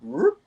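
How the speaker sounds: quick high-pitched whoop; time-warp whoop. Retold or imitated. Storyteller mimicking a time warp, high swooping whoop. Imitates the time warp's sound.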